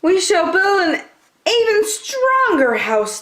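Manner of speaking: little wolves. Acted. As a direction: resolve in tiny voices. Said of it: "they said".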